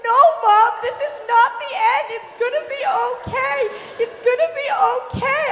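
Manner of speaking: high, loud panicked pleading; freaking out. Retold or imitated. Fourteen-year-old to doomed-sounding mom, high frantic shout. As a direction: act out a frantic teenager yelling reassurance. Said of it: "I'm freaking out".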